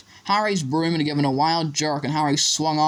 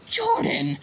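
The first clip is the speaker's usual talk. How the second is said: very high, sharp cut-in; mild growled reprimand. A narrator's reading. Professor reprimanding the biased commentator, sharp reprimand. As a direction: cut in with a warning call. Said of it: "growled Professor McGonagall".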